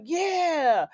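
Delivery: high, drawn-out rowdy yell of agreement; mock crowd cheer. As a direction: imitate a pirate crew egging him on. Acting out pirates cheering the order.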